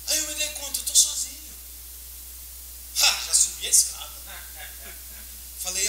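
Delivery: ordinary delivery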